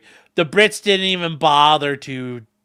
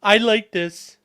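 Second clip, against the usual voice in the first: contented stubborn horse; 6 semitones higher, slower than usual. Retold or imitated. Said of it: "he's like".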